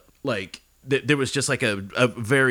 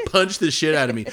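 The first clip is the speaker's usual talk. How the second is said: ordinary talk, nothing marked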